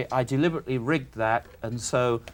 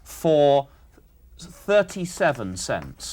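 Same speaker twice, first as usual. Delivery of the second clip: slow, with a gap before the number; slow dictation; much slower than usual. Real, not acted. Questioner dictating the selling price, slowly. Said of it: "I'll say it slowly".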